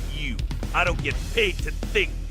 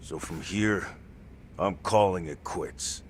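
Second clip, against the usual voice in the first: low, weary admission; subdued confession; 7 semitones lower than usual. Acted. Beaten fighter tells Kaito he is quitting, subdued. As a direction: let it sink low and regretful.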